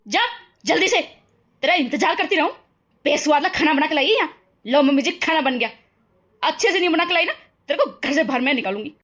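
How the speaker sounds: loud, hard shouting mother-in-law; mother-in-law shouting at the bride; furious accusation. Acted. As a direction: hurl the accusation at her.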